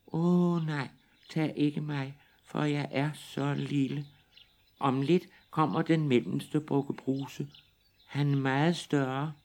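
high, pleading little goat voice. Acted. Little goat begs the troll to spare him, high. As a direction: beg for mercy in a tiny voice.

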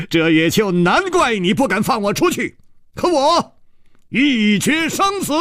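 high, taunting challenge; bold, ringing bravado after a laugh; laughing defiance. A narrator's reading. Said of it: "楚留香忽然大笑".

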